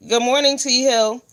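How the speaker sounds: cheerful live welcome; upbeat greeting. Real, not acted. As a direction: toss a quick shout-out.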